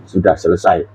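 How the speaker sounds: low, soft, spent; calm quiet finish; quietly said. Acted. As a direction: say it softly, almost to yourself. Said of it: "Terus berkata".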